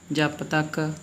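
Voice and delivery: ordinary talk, nothing marked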